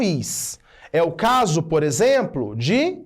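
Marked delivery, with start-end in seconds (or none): none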